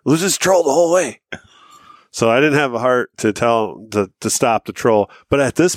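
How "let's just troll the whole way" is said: higher-pitched mock hurt pleading. Friend wants to keep trolling on the way home, mock hurt. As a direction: imitate a wounded friend, pitched up. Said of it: "he is all hurt".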